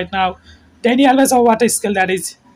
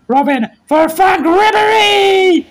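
excited cry; 8 semitones higher, slower than usual. Real, not acted. Commentator calling Robben's pass to Ribery, excited shout. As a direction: cry out the chance. Big chance for Bayern.

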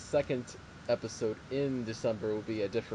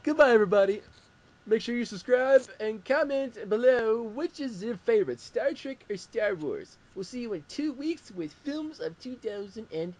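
high, bright show-host outro; announcer sign-off; 12 semitones higher, 6 dB louder than usual. Acted.

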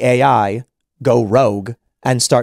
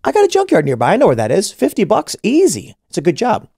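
higher, upbeat, pleased with the easy money; eager gig worker; 6 semitones higher than usual. Acted. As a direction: sound keen about the easy job. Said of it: "they're gonna be like".

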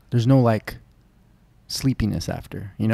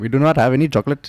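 put-on quick, pitched-up Indian accent; 3 semitones higher, 4 dB louder, faster than usual. Second friend joining the shop bit, Indian accent. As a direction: insist briskly in a mock Indian accent.

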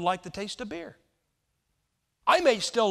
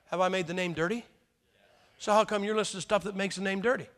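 ordinary talk, nothing marked

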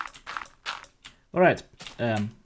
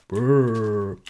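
zombie groan; 4 semitones lower, 4 dB louder than usual. Pretend. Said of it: "I'm a better zombie than you are".

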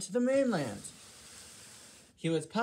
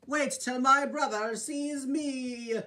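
higher, slow, gloating; proud anticipation; 7 semitones higher, slower than usual. Acted. Gordon gloating about his long train, slow and higher. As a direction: savor the moment before showing off.

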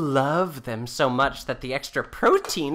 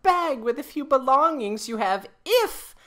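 stern demand; 7 semitones higher than usual. Acted. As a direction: lay down the law to him. Wife lays down her terms.

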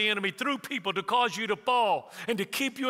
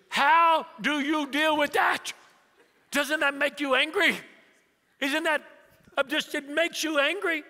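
high, loud mock outrage; exasperated son; 8 semitones higher, 4 dB louder than usual. Son presses dad about Mama, high and indignant. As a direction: imitate a son pressing in disbelief. Son demands dad's answer.

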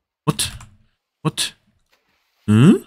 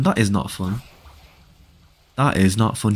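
ordinary delivery

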